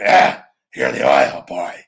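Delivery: put-on the boss spotting the oil boy in the hallway, booming; Vince impression. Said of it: "Maybe Vince".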